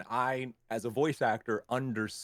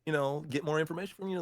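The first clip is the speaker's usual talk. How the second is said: quick, slightly artificial pitch voice; artificial fast pitch voice; 5 semitones higher, faster than usual. Retold or imitated. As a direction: rattle it off a bit artificially. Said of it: "this one sounds a little artificial".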